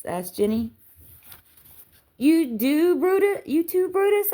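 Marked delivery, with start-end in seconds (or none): 2.2-4.3 very high, incredulous squeal of a question; hammed-up, high-pitched accusation of treachery; mock betrayal. A narrator's reading. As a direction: cry it out in theatrical disbelief, voice soaring. Mock betrayal reply to Jenny.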